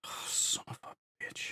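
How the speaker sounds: breathless, slow whispered swear under the breath; whispered curse. Real, not acted. Player cursing the blocked turbolift in a slow whisper. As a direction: hiss the curse out slowly, barely voiced.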